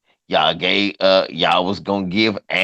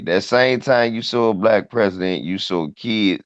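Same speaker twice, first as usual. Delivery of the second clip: ordinary talk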